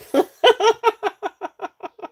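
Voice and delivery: high helpless giggle. Real, not acted. Seller laughing at her own accent. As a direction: let a high laugh spill out.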